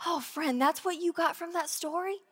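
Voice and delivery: soft, incredulous; hushed mild disbelief; gentle disbelief. Retold or imitated. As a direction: quietly wonder at them. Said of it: "And it's like".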